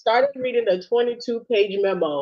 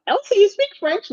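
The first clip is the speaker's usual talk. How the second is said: much higher, imitating someone eagerly asking; high mock asker; 13 semitones higher, 4 dB louder than usual. Fan pestering Elsie about French, high mock voice. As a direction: pitch it way up like a curious fan. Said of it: "Somebody be like".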